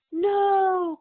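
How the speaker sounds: high, loud cry of shock and grief; anguished cry. A narrator's reading. Henry cries out on hearing his family was sold, loud anguish. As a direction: cry it out as the worst news lands. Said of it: "cried Henry".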